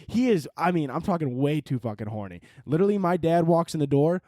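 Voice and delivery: ordinary talk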